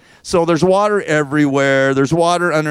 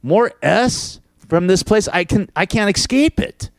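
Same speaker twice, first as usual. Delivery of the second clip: higher, exasperated rant at the plumbing; fed-up disbelief; 6 semitones higher, slower than usual. Retold or imitated. Homeowner ranting at his backed-up sink, higher and fed up. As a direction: voice your own disbelief that it's happening again. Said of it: "And I'm just like".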